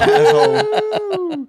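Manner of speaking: very high, drawn-out laughing "Asshole"; laughing echo. Real, not acted. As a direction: stretch it out squealing with glee. Echoing insult with laugh.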